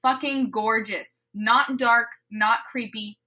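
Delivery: ordinary talk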